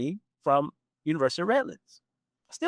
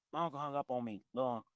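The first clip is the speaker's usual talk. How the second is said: normal talking voice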